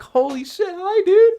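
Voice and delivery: very high, loud squeal; excited greeting. Real, not acted. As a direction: squeal a thrilled hello. Greeting creator in chat.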